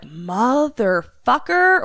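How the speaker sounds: slow, high, loud mock curse; drawn-out curse. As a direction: stretch out the swearword, rising in pitch. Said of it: "or more like".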